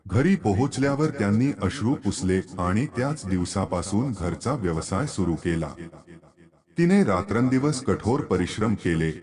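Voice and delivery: usual speaking voice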